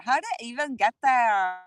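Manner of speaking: mock Valley girl with a swooping pitch. Mimicking herself after the picnic table remark, Valley girl. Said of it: "I totally sound like a Valley girl".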